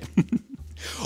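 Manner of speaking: quick unvoiced giggle; little self-conscious laugh; breathy laugh. Real, not acted. Embarrassed laugh after goodbye.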